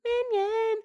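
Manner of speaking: very high, squealed little word; playful falsetto squeal; squeaky Minion cheer. Retold or imitated. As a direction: chirp it in a tiny squeak. Imagined cheer beside the Minion.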